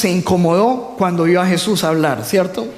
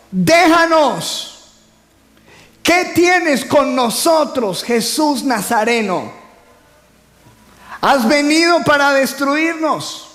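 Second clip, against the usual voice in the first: high, slow cry of a possessed man; preacher voicing the demon's cry in the synagogue, high and drawn out; 6 semitones higher, slower than usual. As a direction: voice the demon shouting at Jesus. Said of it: "exclamó a gran voz".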